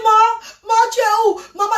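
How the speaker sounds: high, tearful cry for mother. Retold or imitated. Daughter crying for her vanished mother, high and tearful. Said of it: "je commence à crier".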